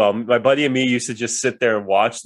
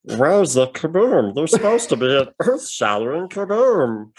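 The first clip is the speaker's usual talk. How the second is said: Marvin the Martian. Acted. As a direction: draw out each phrase like a cartoon Martian. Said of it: "do Marvin the Martian".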